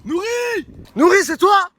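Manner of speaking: loud high shout across the lake; calling out. Real, not acted. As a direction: yell a name to someone far away. Appelle un ami perdu. (appel crié au loin)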